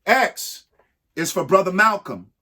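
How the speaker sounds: ordinary delivery, nothing marked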